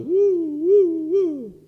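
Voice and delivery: playful rising "woo woo woo"; high whoops. Pretend. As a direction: whoop each one like riding a rollercoaster.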